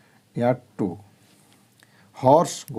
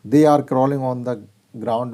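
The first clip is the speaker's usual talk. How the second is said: slow reading aloud; much slower than usual. Shown as a demonstration. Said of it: "reading example sentences slowly".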